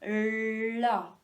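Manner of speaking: "la" with a very long l; stretched syllable. Shown as a demonstration. Teacher naming the first syllable, stretched sound. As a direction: hold the l before opening into "la". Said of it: "La première, c'était".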